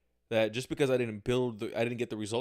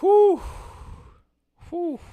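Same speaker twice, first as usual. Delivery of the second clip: sharp high whistling exhale, much louder; loud high whew; 13 dB louder than usual. Real, not acted. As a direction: blow it out high like relief. Exhale after heavy thought.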